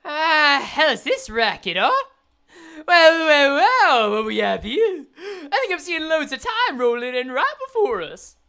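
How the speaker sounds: loud, swaggering thug voice; hammed-up street-gang boss; odd character voice. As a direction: drag out the greeting like a gloating villain. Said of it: "I don't know what this voice is".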